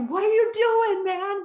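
high, loud incredulous shout; kids yelling. Retold or imitated. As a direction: yell at a teammate running the wrong way. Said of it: "the kids are like".

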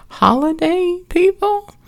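drawn-out high mocking punchline; sarcastic. Real, not acted. Joking to listeners about holiday romances, high and drawn out. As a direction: stretch it slowly, high and mocking. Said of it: "sarcastic punchline".

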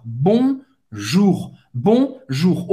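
"Bonjour" com ON nasal e R gutural; French bonjour. Shown as a demonstration. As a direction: diga "Bonjour" devagar, modelo de professor. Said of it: "O N em francês tem o som do um". ("Bonjour" com som nasal e R arranhado)

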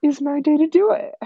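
put-on high, loud, whiny kid voice; the rival kid insists in a squeaky voice; mock rival kid. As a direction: whine it high like a stubborn kid.

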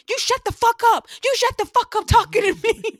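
high, full-force screamed outburst; furious yelling. Retold or imitated. Imitating panicked friend telling off boy, furious scream. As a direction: scream it at the top of your voice.

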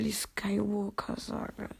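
ordinary delivery

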